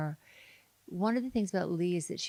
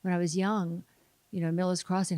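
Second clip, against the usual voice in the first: ordinary talk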